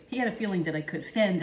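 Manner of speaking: usual speaking voice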